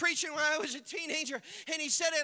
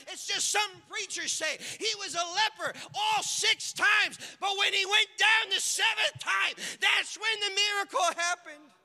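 loud, high preaching build; full-force preaching; 7 semitones higher, 6 dB louder than usual. Acted. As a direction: drive it home to the crowd.

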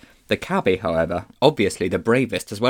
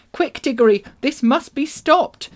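put-on high, loud, urgent child's voice; urgent girl; 6 dB louder than usual. A narrator's reading. Polly urging Diggory to act, urgent high call.

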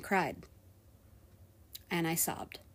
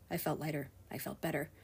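relieved; 5 semitones lower, 12 dB quieter, faster than usual. Real, not acted. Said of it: "relief after leaving work".

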